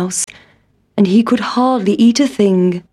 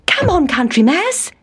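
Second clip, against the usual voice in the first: mock yell; 6 semitones higher than usual. A narrator's reading. Town Mouse hurrying his cousin to the car, mock yelling. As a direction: call out in a raised mouse pitch. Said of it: "he yelled".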